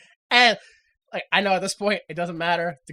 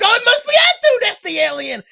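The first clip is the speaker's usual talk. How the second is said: high, loud frantic cry; hammed-up crowd panic; mock mob accusation; 11 semitones higher, 6 dB louder than usual. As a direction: imitate hysterical finger-pointing. Acts out mob accusing again.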